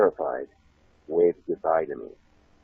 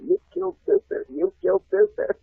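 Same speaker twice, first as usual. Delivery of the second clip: high mock kids' scream; kids screaming. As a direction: shriek it like panicked schoolkids. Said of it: "all the kids screaming".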